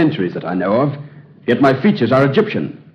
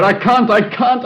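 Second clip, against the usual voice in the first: desperate pleading; 11 semitones higher than usual. Acted. Distraught groom tells the doctor he can't marry, panicked high pleading.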